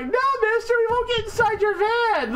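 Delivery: very high mock child voice; hammed-up high-pitched stranger-danger cry; imitated frightened kid. Kid refusing a man with a van, mock child voice.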